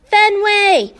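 high, loud yell of the name. A narrator's reading. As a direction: shout for the dog across the park. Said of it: "Hattie yells".

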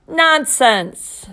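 loud booming nonsense. A narrator's reading. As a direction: boom it like a speech to citizens. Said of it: "Dad boomed".

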